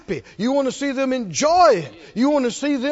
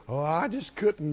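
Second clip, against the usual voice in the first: lower, softer feigned modesty; mock humble refusal; 4 semitones lower, 6 dB quieter than usual. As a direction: decline the gift in mock humility. Mocking falsely humble refusal.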